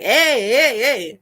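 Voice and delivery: loud calling-out, mimicking a man; loud, repeated mock hail; imitated catcall. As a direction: call out for attention, mockingly.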